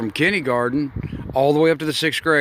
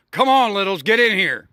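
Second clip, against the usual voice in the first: high, cheerful call to gather; 6 semitones higher than usual. Real, not acted. Calling the kids in.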